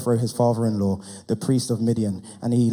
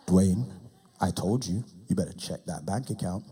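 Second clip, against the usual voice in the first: low warning God; 6 semitones lower, 6 dB quieter than usual. Acted.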